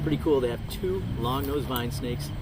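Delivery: ordinary talk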